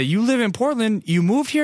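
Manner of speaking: high-pitched retold disbelief; high, acted-out incredulous questions; surprised questioning. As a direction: replay not believing the news.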